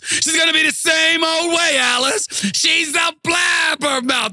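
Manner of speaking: high-pitched exasperated sitcom yell; comic eruption, voice jumping way up; Ralph Kramden outburst. Retold or imitated. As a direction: blow up at the wife, drawing out the last word. Kramden's calm gives way to outburst.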